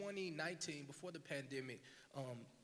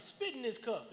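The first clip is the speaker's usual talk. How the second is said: bossy friend; 8 semitones higher, 7 dB louder, slower than usual. Retold or imitated. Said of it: "she was just like".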